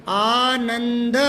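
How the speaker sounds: teacher slowly drawing out Anand; slow, drawn-out spelling aloud. Shown as a demonstration. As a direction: stretch Anand slowly, syllable by syllable. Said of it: "ये आनंद लिख दो".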